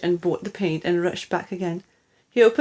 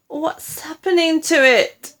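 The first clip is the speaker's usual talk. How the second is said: higher, louder cry of alarm; 8 semitones higher, 4 dB louder than usual. Acted. Mister Killiecranky watching the chicken's legs grow, alarmed cry. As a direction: call out in startled worry. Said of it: "cried Mister Killiecranky".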